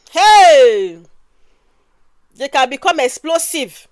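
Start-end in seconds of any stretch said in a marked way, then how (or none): 0.1-1.0 loud, drawn-out hey; sudden raised-voice scolding call; mock parental shout. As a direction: imitate a parent shouting at a child. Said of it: "you start shouting at them".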